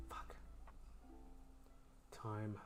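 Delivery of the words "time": normal talking voice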